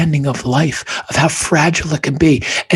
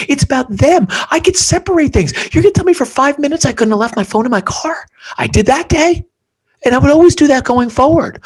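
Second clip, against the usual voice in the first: much higher, emphatic; 12 semitones higher, 4 dB louder than usual. Real, not acted. Emotional peak of the story.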